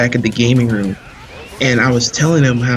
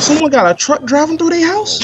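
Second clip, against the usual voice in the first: surprised; 13 semitones higher than usual. Real, not acted. Reacts to background noise.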